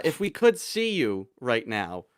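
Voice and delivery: usual speaking voice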